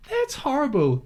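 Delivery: high-pitched, appalled reaction; retold shocked sympathy. Recalling her reply to a mistreated woman, shocked sympathy. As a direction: react aghast on her behalf, pitched well up.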